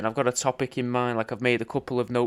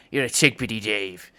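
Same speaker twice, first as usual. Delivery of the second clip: mock angry; 3 semitones lower than usual. Shown as a demonstration. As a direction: grumble it like a mild insult. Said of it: "angry, like".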